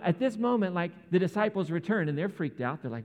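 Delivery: ordinary talk, nothing marked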